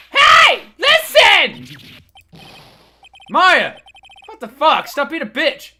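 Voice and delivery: high, loud yelling at her; shouting girl. Acted. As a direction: yell at the stuck-up woman to listen. Voicing yelling Maya.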